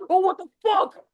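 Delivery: high, loud shock; acted startled yelp of disbelief; startled outburst. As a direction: jump out of your skin, mock fright. Acts being startled by a voice.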